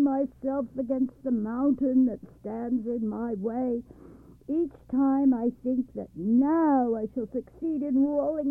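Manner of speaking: high, loud raging complaint; angry roar. Acted. Wind raging at the old man about the mountain. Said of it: "he roared at Machbel".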